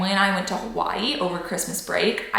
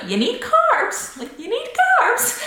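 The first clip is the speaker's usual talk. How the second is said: mimicking dietitian; slower than usual. Quoting the dietitian's insistence.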